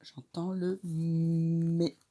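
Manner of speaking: slow J'entends le mé; drawn-out mé; slow model answer. Shown as a demonstration. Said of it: "Qu'est-ce que j'entends dans".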